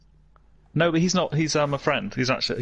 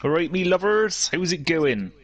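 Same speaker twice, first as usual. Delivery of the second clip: put-on Bristol greeting, pitched up; Bristol accent; 3 semitones higher than usual. Streamer greeting viewers, in a Bristol accent. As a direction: greet them in broad Bristol. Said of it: "That was clearly a Bristol accent".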